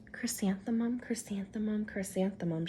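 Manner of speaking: soft unvoiced whisper, fading; whispered name. A narrator's reading. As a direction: murmur it sadly to yourself. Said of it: "she wrote".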